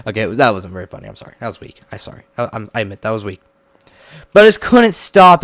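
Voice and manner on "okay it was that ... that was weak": quick, low, soft admission; sheepish apology. Real, not acted. Apologizing to viewers for the weak pun, sheepish and quiet. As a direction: drop right down and own up to a weak joke. Backing down after failed pun.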